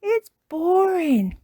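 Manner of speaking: put-on high, slow child's whine; Peppa Pig. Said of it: "says Peppa".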